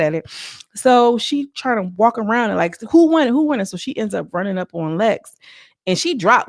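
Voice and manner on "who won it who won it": raised, louder mock challenge; imitating Ivory calling out the winner, loud and cocky; mock boastful call. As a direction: strut and call it out, a bit higher. Ivory strutting around demanding the win.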